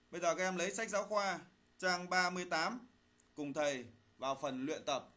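ordinary talk, nothing marked

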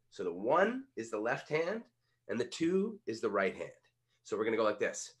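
ordinary talk

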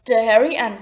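ordinary delivery, nothing marked

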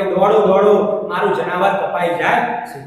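usual speaking voice

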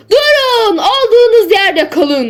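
high, loud, urgent shout; shouted warning. A narrator's reading. Bridge keeper warns travellers the bridge has collapsed, shouting. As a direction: yell a warning across the distance. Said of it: "Elini sallıyor ve bağırıyordu".

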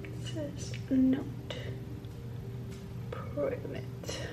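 quiet, disappointed whisper trailing off; fading whisper. Real, not acted. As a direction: let it fade to nothing, let down. Fading after negative result.

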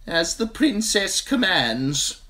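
high, slow, grudging merchant; sullen merchant. A narrator's reading. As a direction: give in sullenly. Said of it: "sullen glance".

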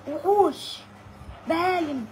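retold shout of insults. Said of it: "كل اللي بيبص يقول له".

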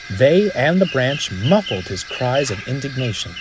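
ordinary delivery, nothing marked

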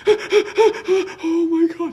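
high, slow wailing fright; mock terrified high-pitched voice; imitated panic. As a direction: squeal it like someone waking terrified. Imitating her waking in panic.

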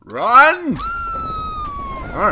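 high, loud shout to run; urgent shout. Real, not acted. Gamer fleeing an attacker, urgent high shout. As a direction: yell at himself to get away.

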